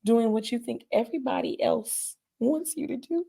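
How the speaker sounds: softly retelling her late father's words in the dream; father's gentle words. Retold or imitated. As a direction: quote him tenderly, holding back tears. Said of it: "he said to me".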